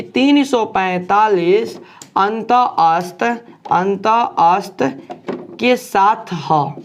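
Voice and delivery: drawn-out words with pauses between; slow dictation. Shown as a demonstration. Teacher dictating a formula for students to write, slow dictation. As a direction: dictate very slowly, stretching each word.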